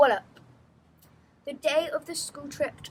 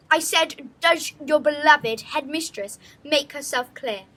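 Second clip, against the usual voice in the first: louder, firmer repeat of the question; insistent repeat; 3 semitones higher, 10 dB louder than usual. Acted. Headmistress repeats to murmuring pupils, louder and insistent. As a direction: demand an answer this time.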